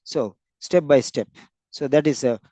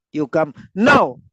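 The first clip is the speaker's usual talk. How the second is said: "now" punched loud at the end; stress on NOW; 5 dB louder than usual. Shown as a demonstration. Teacher meaning not later, NOW punched. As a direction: hold back "You come", then hit "now". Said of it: "Not one minute later".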